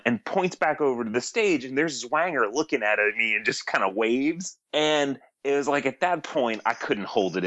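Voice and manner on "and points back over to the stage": ordinary delivery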